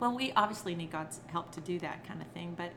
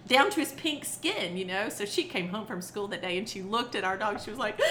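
ordinary talk

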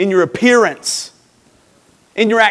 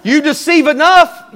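raised, higher preaching voice; emphatic warning; 8 semitones higher, 5 dB louder than usual. Real, not acted. Preacher warning the congregation about deceiving, emphatic. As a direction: drive it home loud and high.

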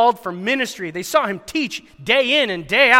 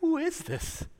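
amazed; 10 semitones higher, 7 dB quieter, slower than usual. Acted. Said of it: "In fear and amazement".

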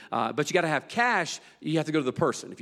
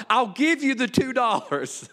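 mock holler; 7 semitones higher, slower than usual. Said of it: "this guy in the back hollers out".

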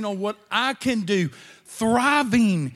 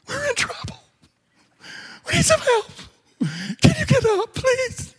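much higher, louder frantic plea; 4 dB louder than usual. Pretend. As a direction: cry out to a sleeping Jesus for help. Disciples calling Jesus for help.

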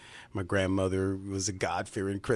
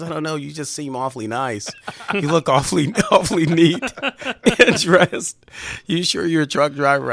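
lightly voiced woman, pitched higher and much louder; teasing suspicion, voice lifted up high; voicing a suspicious woman; 6 semitones higher, 9 dB louder than usual. Undercover cop voices a suspicious woman.

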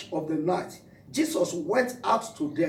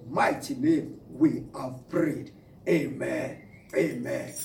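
slow, lower amen to close the prayer; closing prayer; 5 semitones lower, slower than usual. Acted. Pastor ending his prayer, slow low amen.

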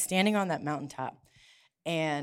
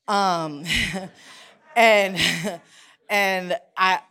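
laughing; 9 dB louder, much slower than usual. Real, not acted. Laughing nervously before painful news.

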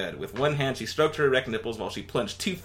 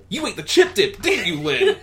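high, louder Alf cracking up; Alf laughing; 6 dB louder than usual. Acted. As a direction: accuse her while giggling.

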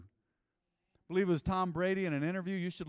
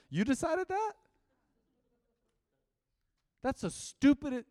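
incredulous; 10 semitones higher, 6 dB louder than usual. Real, not acted. Mocking a careless claim.

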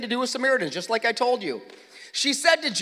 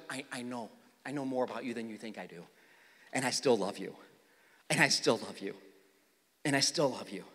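much lower and softer, gently reassuring; tender Jesus; 9 semitones lower, 7 dB quieter than usual. Acted. Jesus to the woman, softly and lovingly reassuring. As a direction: quietly let her know she is loved.

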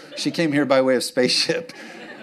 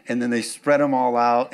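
normal talking voice

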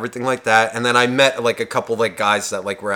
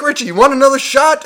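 put-on high, loud party-girl offer; raised, eager mock female voice; excited party girls; 11 semitones higher, 5 dB louder than usual. Voices happy girls ordering shots.

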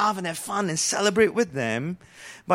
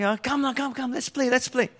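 put-on high, sing-song call of a child inviting friends to play; 6 semitones higher than usual. Preacher voicing children calling friends to come play, high and sing-song. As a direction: call out to your playmates like a kid.

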